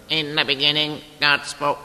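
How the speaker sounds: put-on American Bible-reader voice; mock American voice. Showing the congregation the unwanted Bible narrator, in a mock American accent. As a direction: read scripture in an imitation American accent. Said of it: "not one of these American voices".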